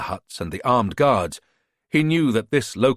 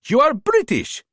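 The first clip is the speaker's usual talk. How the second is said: put-on higher-pitched, smooth-talking hustler with a foreign accent; charming border fixer; 4 dB louder than usual. A narrator's reading. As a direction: sell yourself like a friendly tout. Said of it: "fluent, confident English".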